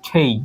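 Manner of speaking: "খেই" with a puff of air on k and an ay glide; correct letter K. Shown as a demonstration. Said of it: "but এটার উচ্চারণ হবে". ("খেই" শ্বাস-মেশানো খ, শেষে ই)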